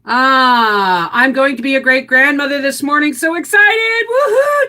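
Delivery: high, loud excited reading; high, loud happy whoop for the viewer; excited cheer. Real, not acted.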